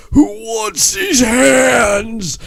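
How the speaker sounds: mock wrestler shout at the beach; Braun Strowman shout. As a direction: bellow it like a wrestler calling out the crowd. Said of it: "he goes".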